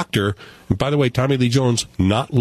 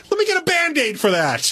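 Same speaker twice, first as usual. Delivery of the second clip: sarcastic imagined line; 12 semitones higher than usual. Retold or imitated.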